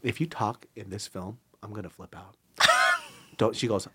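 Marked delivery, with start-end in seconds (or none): 0.0-2.3 soft retold warning, trailing away; hushed, understated ultimatum; low-key threat. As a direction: warn her quietly, fading down. Retold warning before the film.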